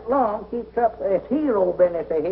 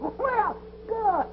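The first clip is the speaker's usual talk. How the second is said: delighted; 11 semitones higher, 5 dB quieter than usual. Acted. Abner delighted at promised game.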